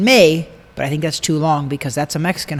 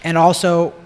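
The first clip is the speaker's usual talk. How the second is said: normal talking voice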